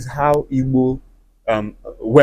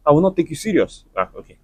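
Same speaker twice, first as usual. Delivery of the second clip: usual speaking voice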